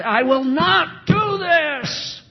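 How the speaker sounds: high, loud, slow shout of refusal; firm refusal. Retold or imitated. Young believer shouting a firm refusal to temptation. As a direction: shout the vow like iron resolve.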